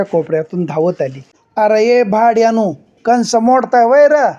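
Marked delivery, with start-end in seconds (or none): 1.6-4.3 furious shout, higher and louder; angry woman yelling. Acted. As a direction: bellow at the kids stealing corn. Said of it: "एक बाई ओरडत, शिव्या देत".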